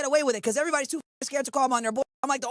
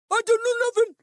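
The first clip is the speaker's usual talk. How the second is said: high, loud mimicked denial; mock protesting suspect; 8 semitones higher, 5 dB louder than usual. Streamer imitating a suspect caught on camera, mock protest. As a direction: pitch it way up as a mocking protest.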